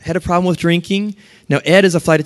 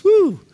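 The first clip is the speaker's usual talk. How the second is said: sudden high-pitched "woo"; a jolt awake from smelling salts; startled whoop. Acted. Preacher mimicking someone hit by smelling salts, startled whoop.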